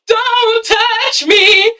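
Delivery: high, drawn-out singsong threat; mock threat. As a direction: stretch every word high, hamming it up. Imagined threat she waited for Chris to make.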